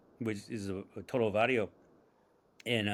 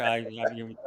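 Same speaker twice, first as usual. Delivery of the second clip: ordinary delivery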